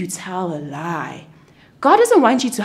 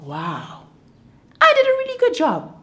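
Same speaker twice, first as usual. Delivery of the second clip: a light, high, pleased God admiring his work; proud of what he's made; playful God voice. Acted. God admires the garden, lightly voiced and pleased.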